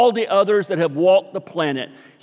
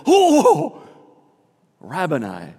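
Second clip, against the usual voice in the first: overjoyed gasp; 3 semitones higher, 5 dB louder, slower than usual. Shown as a demonstration. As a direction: gasp with joy, then soften on "Rabboni". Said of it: "I think she just".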